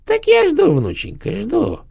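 put-on falsely meek, very high old woman; mock meek. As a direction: pretend patience, sweetly poisonous. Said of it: "кротко ответила старуха".

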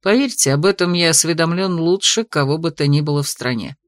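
low, amused, condescending male voice; a lightly voiced smug dragon. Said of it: "снисходительная ирония".

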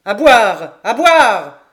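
very loud roar for drink; roaring drunk demanding a glass; drunken bellow. A narrator's reading. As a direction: howl it out at full volume. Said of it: "il hurla".

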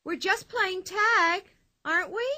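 innocent, mock-hurt plea; reproachful. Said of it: "asked Pippi reproachfully".

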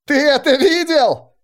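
high, loud, slow excited yell; excited shout. Acted. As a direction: shout it out thrilled after battle. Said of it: "кричал Райснер".

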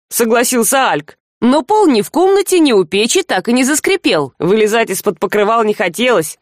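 usual speaking voice

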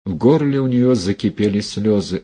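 usual speaking voice

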